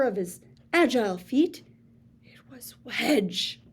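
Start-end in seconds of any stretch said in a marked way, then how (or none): 2.2-3.6 slow hushed whisper; breathless dramatic hush. Acted. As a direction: whisper the name like a shocking reveal. Dramatic reveal of rival.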